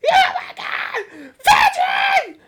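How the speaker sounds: very high, screeching mock cheer; mocking wife impression. Host mocks quarterback's wife cheering him, very high screeching impression. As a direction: screech it like an annoying football wife. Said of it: "You ever heard her speak?".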